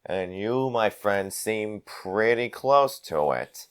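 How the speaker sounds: slow, deliberate brawler, softening at the end; menacing avenger. Acted. Avenger accusing the gnoll of harming innocents, slow menace. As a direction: draw out each phrase as a veiled threat.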